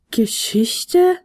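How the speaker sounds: "Geschichte" repeated as a question, pitch rising at the end; questioning echo. A narrator's reading. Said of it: "wiederholte der kleine Flötenspieler fragend". („Geschichte“ fragend wiederholt, am Ende steigend)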